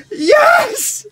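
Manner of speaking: high, loud cry of joy; triumphant shout. Real, not acted. Host celebrating that Fellaini has gone, shouting in triumph. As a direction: yell it like a goal went in.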